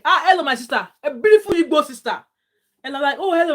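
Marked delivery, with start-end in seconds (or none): 0.0-2.2 high, loud, delighted hello; big cheerful welcome; excited greeting. Retold or imitated. New colleague greets her warmly. 3.2-3.5 high, loud, startled hello back; bright surprised reply. Retold or imitated. Her surprised reply to greeting.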